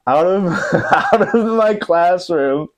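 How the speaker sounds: words broken up by real laughter; laughing while talking. Real, not acted. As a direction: crack up mid-sentence, pitch rising. Cracking up at own story.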